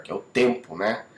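ordinary talk

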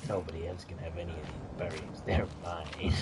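deep possessive hoarding growl; guard the berries like a miser; low greedy voice. Pretend.

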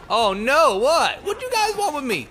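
high, loud comic alarm; mock panic. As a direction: play hammed-up alarm at the attackers. Pretends fear of guards shooting.

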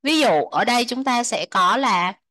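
usual speaking voice

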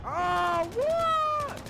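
loud, very high-pitched disbelief; shocked outcry. Real, not acted. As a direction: yell in sheer astonishment, voice shooting up. Stunned by incoming donations.

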